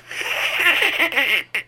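high-pitched cackle; another Russian laugh. Acted. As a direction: show a second kind of laugh. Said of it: "How many laughs are there".

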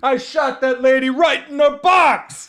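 high loud cartoon killer; caricature killer. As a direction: boast absurdly. Mock killer confession bit.

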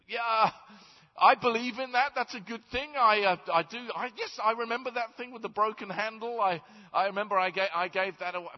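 high, loud, eager pleading; eager nervous man. Acted. The man pleads his case to Peter, high and eager. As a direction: pitch up like a flustered man talking fast.

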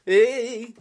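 very high mocking caricature voice; Paul. Co-host mimics the host to his face, high-pitched mocking impression. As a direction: squeak out a silly take-off of your co-host. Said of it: "I'm doing my Paul voice now".

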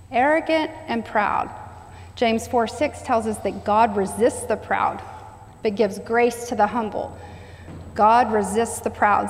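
ordinary talk, nothing marked

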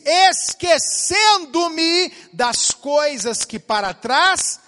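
slow, loud, weighty proclamation; preaching scripture. Acted. Pastor proclaims the verse to the congregation, slow loud preaching. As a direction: drag out each word of the verse like a pulpit declaration.

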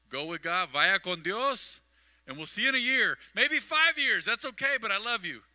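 loud, high, hammed-up send-off; hearty farewell. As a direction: call out a big goodbye to relatives. Acted cheery send-off to distant family.

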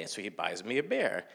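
ordinary delivery, nothing marked

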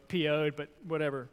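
ordinary talk

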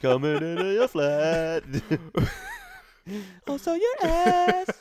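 very high, squeaky hammed-up British voice; mock British accent. A buddy teases his cohost about the flat in a squeaky British accent. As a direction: squeal it like a cartoon Brit, way up high. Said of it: "Who invited the British guy".

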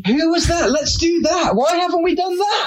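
high, bright burst of excitement; eager, overjoyed at the idea; excited. Real, not acted. To co-hosts about an American-accent show, thrilled and high.

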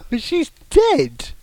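high, drawn-out protest; exclaiming. Real, not acted. Co-host objecting about the ghost story, high exclamation. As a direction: let the voice leap up in disbelief.

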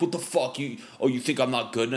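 high, mock-outraged comeback; mock butthurt. Touchy comedian answering a suggestion, mock butthurt and defensive. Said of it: "they get, like, butthurt".

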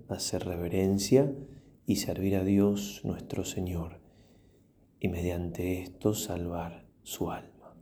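ordinary talk, nothing marked

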